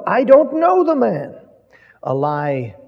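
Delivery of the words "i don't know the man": "know" in "I don't know the man" hit high and loud; emphatic denial. Acted. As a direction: Peter swearing he doesn't know him, loud and high. Peter's second denial, with oath.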